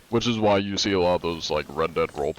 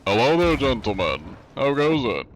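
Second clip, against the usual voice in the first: polite, raised official greeting; formal inspector voice; 4 semitones higher, 4 dB louder than usual. Acted. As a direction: play the stiff cheat inspector. John Anti-Cheat greets crew in character.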